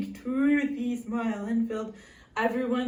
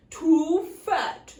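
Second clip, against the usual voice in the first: goat voice; 4 semitones higher, 10 dB louder than usual. Acted. Goat's verdict.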